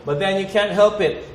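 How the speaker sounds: ordinary delivery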